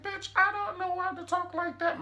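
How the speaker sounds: high put-on sassy voice; mock Jeffrey voice. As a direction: snap back in a campy high voice.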